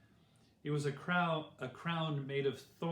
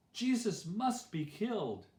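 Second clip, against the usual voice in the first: higher-pitched, emphatic demand; crying out; 7 semitones higher than usual. A narrator's reading. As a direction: insist like angry rulers crying out. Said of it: "cried the rulers".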